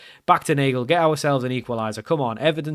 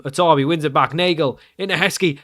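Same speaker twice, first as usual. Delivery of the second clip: ordinary talk, nothing marked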